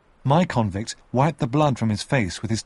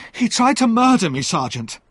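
higher, louder accusing cry on "murder"; loud accusation; 11 semitones higher, 5 dB louder than usual. Acted. The young convict accuses his attacker to the sergeant, loud. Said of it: "the young man said".

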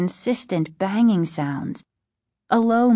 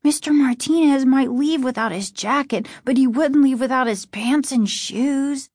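put-on a bit higher, mildly alarmed boy; lightly voiced worried boy; 6 semitones higher than usual. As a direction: lightly play a boy reasoning through bad news. Narrator voices the boy Casey.

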